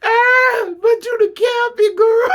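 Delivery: high loud squeal of praise; laughing shout. Real, not acted. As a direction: scream it through tears of laughter. Cheering Mary, cracking up.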